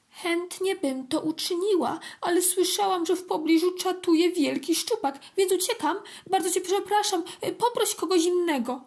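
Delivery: high, hurried-to-leave fish voice; apologetic little fish. A narrator's reading. Little fish refuses the ant for fear of pike, apologetic.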